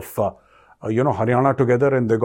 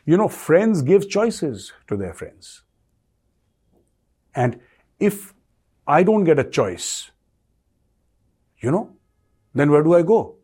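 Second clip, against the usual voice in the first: slow, higher, measured quote voice; a hint of Jaishankar's calm diplomatic tone; quoting Jaishankar; 4 semitones higher, slower than usual. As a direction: deliver the minister's line slowly and knowingly. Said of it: "he says".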